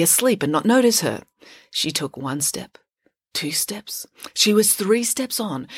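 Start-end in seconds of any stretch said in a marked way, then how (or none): 1.7-4.1 dropping to a near whisper; hushed. A narrator's reading. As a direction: tiptoe the words softly. Tiptoeing onto troll bridge.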